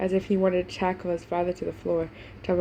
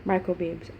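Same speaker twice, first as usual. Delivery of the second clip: ordinary delivery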